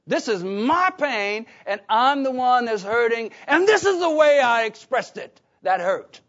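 loud, rising preacher's cry; sermon climax, loud and fervent; full-force preaching. Acted. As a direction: preach it out, building with passion.

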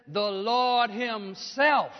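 "The Lord himself." said very slowly, every word weighted; emphatic stress. Shown as a demonstration. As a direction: lean hard and slow on "The Lord himself.". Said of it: "You see how emphatic that is?".

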